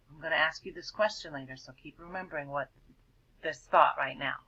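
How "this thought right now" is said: usual speaking voice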